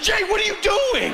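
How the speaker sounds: high-pitched shocked wife; wife's high alarmed cry. Retold or imitated. Wife startled by her husband's prank, a high shocked cry.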